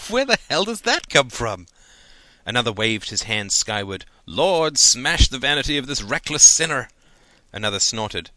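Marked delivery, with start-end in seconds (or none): 0.1-1.7 high, loud incredulous outburst after a puff of laughter; laughing disbelief. A narrator's reading. Man laughs at Gordon's great idea, incredulous and amused. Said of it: "A man laughed". 4.2-6.9 loud, high hammed-up plea to heaven; mock prayer. A narrator's reading. Student mockingly prays for Gordon's punishment, loud declamatory plea.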